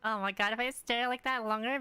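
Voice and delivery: ordinary delivery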